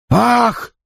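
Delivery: high startled "ah"; sharp cry. Acted. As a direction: cry out as if struck. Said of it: "вскрикнул он".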